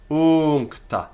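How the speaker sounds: "Uncta" high and loud, the u held; long U. Shown as a demonstration. Teacher repeating the example, "Uncta" with drawn-out u. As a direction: lengthen the first syllable of "Uncta". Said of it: "has got a long u".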